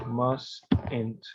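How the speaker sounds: slow "mustn't", MAS, then ENT; pronunciation demo. Shown as a demonstration. Teacher repeats the contraction for the student, slow split "mustn't". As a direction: stretch "mustn't" into two separate syllables without the first t. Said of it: "su contracción se pronuncia así".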